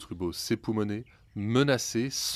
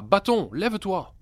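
much higher, loud startled command; shouting; 10 semitones higher, 6 dB louder than usual. A narrator's reading. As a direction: fling out the spell without thinking. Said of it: "Sans réfléchir, il lança".

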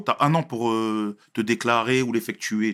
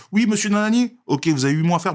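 high, loud retold judge; brusque judge impression; 7 semitones higher, 5 dB louder than usual. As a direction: imitate a judge rattling off the case. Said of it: "j'arrive devant la juge".

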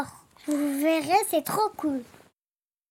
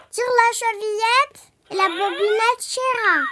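put-on higher, quavering old granny; quavering grandmother; 5 semitones higher than usual. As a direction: tremble like a frail grandmother. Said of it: "chevrota la grand-mère".

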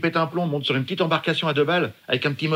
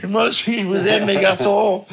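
higher, slow mock cry from the heart; tearful shout; 6 semitones higher, slower than usual. Father calling up the cliff to his sons, higher slow shout. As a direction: call up to the sons, emotional. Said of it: "j'ai crié très, très fort".

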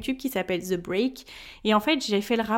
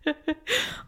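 short high laugh at herself; a quick amused laugh; 6 semitones higher, 5 dB quieter than usual. Real, not acted. Laughs at own staccato speech.

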